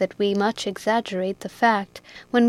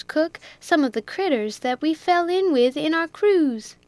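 higher, breezy sailor voice; Jack Tier; 6 semitones higher, 4 dB louder than usual. A narrator's reading. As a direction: keep it light and boastful. Jack's higher voice.

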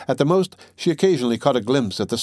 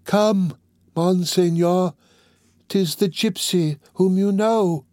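higher, slow, coaxing plea; Quasimodo pleading; 8 semitones higher, slower than usual. Acted. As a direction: lure the captain with the word. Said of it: "exclaimed Quasimodo".

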